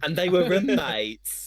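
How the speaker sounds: slow, high mock deadpan; mocking imitation. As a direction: copy that awkward line, hammed up. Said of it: "That's how you just said that".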